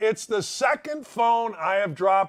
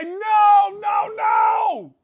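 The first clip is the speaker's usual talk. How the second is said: panicked yell, much higher and louder; shouting no; 11 semitones higher, 6 dB louder, much slower than usual. Retold or imitated. Yelling at the phone going into the water. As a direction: yell it as the phone slides away. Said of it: "Enough for me to say".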